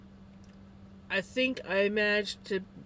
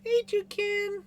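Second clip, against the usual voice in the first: high, loud sing-song hello; excited greeting; 6 dB louder than usual. Real, not acted. Calling out to Toucan the pet, high and loud. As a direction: greet the pet with a bright, much higher call.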